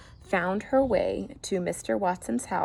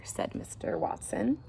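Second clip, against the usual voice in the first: ordinary delivery, nothing marked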